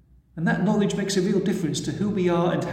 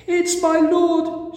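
high, loud and slow through tears; sobbing; 12 semitones higher, 5 dB louder, much slower than usual. A narrator's reading. Mary at the empty tomb, sobbing to the angels. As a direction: choke it out high and slow, grief-stricken. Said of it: "she sobbed".

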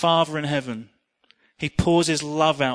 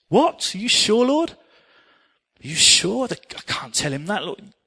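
much higher, louder alarmed protest that fades to a plea; Nathan's disbelief; 6 semitones higher, 4 dB louder, slower than usual. Acted. Nathan protests to God in shocked, then pleading disbelief. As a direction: balk at the order in shocked disbelief, then sink. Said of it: "Can you imagine Nathan's reaction?".

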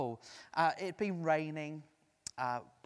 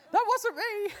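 high, loud squeaky denial; comic shrill protest; mock protest; 10 dB louder than usual. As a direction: pretend alarm disowning it. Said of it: "I said".

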